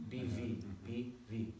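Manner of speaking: "B" with closed lips, "V" with teeth on the lip; contrasting B and V. Shown as a demonstration. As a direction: say "B" then "V" slowly to contrast them. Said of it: "You hear that?".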